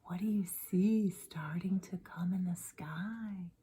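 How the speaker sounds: plain reading voice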